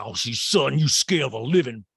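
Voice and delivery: loud, mid-pitched attempt at the voice; false-start mock quote. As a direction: start the imitation without lifting the pitch. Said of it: "She's like".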